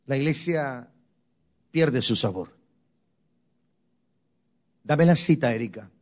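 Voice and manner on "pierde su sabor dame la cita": ordinary talk, nothing marked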